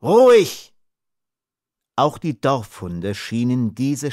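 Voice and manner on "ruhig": loud, higher sharp order; loud barked command. Acted. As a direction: silence the dogs at once. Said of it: "seine befehlende Stimme".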